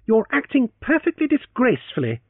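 high, loud mock scolding; wicked teasing. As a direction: tease her with mock reproach. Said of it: "he assured her wickedly".

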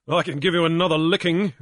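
loud, fast, hard threat; captain threatening another beating; Grief threatening. Acted. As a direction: answer him with a threat. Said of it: "Grief answered".

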